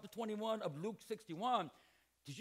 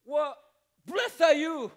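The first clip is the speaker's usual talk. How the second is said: a loud, syrupy blessing with a bite; mock sweet praise; 4 semitones higher, 11 dB louder, slower than usual. Jesus mock-praising Peter, loud and sugary. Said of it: "That's passive aggressive".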